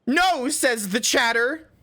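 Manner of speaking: high-pitched defensive whine; squeaky high voice. Real, not acted. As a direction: squeal it out, flustered. Defensive squeaky bat chest rant.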